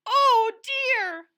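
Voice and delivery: very high, loud mock wail; cartoon wail. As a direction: wail it like a frightened loon. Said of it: "wailed Loony Little".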